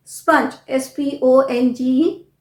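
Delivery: ordinary talk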